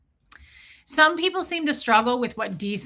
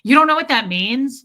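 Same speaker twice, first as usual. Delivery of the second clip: condescending mockery; 3 semitones higher, 8 dB louder than usual. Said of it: "condescending shit".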